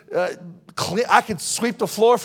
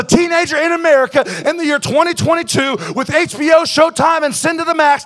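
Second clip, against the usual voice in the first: loud, high, rising sermon shout; roaring revival preaching; full-force preaching; 7 semitones higher, 8 dB louder than usual. Real, not acted. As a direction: preach it with all your fire.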